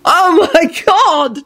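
high, drawn-out exclamation; shout. A narrator's reading. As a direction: cry it out slowly, up high. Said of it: "George shouted".

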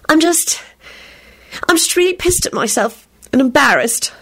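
high, wailing daughter, near tears; a lightly acted wail of self-pity. A narrator's reading. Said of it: "she wailed".

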